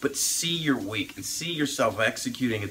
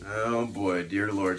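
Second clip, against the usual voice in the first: usual speaking voice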